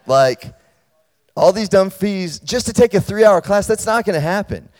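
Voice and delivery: student mock-yelling at the department chair; retold yelling. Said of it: "we just yelled at each other".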